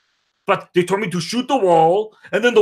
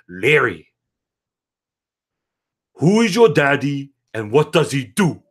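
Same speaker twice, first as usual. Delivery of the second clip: menacing low voice; 5 semitones lower than usual. Retold or imitated. Menacing question to Larry.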